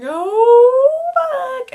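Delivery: high, loud burst of star-struck panic; overwhelmed fan blurting at her idol; can't contain excitement. Retold or imitated. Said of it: "I can't contain my excitement".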